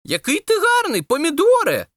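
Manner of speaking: high-pitched cry of admiration; delighted exclamation. A narrator's reading. Watermelon admiring its friend the tomato, delighted. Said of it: "кавун зойкнув від захоплення".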